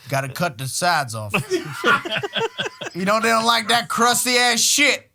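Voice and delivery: lightly voiced bossy lady of the house; mock rich mom. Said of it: "Maria the maid".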